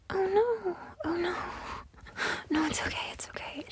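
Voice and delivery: put-on high, babying oh no, teasing pout; mock pity. Woman teasing the date she just slapped, mock pout. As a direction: coo it like pitying a toddler.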